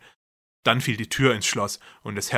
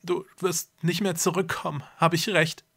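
tearful whisper; 7 semitones higher than usual. A narrator's reading. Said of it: "flüsterte mit tränenerstickter Stimme".

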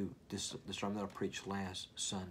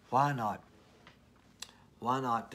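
louder, punched why not; loud rhetorical questions; emphatic shout; 3 semitones higher, 8 dB louder, slower than usual. Shown as a demonstration. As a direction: demand it of the crowd. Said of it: "Why not shouting it?".

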